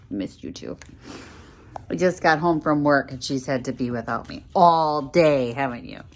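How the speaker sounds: ordinary talk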